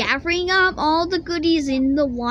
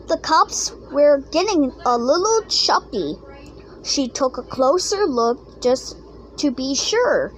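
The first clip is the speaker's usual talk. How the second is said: ordinary delivery, nothing marked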